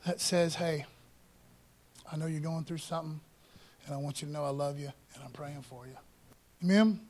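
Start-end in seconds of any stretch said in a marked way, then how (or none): 0.5-6.0 low, soft, quick word of comfort; gentle encourager. Acted. Church member to someone going through something, gently reassuring. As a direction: quietly reassure a hurting friend. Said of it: "God speaks to the person that says".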